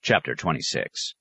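plain reading voice

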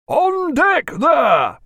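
high, drawn-out call; long-held seaman's hail; hail from aloft. A narrator's reading. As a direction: call down to the deck from the masthead. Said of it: "came Jack's voice from on high".

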